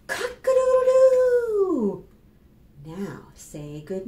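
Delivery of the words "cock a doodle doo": long, high, loud rooster crow. Acted. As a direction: crow the morning awake. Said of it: "You began the day".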